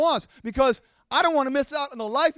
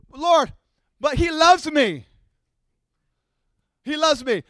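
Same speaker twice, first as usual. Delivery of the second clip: put-on pleading girl; 4 semitones higher, 6 dB louder, slower than usual. Voicing a teen girl defending.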